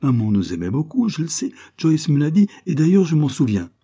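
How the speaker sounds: rising indignation, acted by a narrator; angry child. A narrator's reading. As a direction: argue back hotly as a cross little sister. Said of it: "interrompit Lucie avec colère".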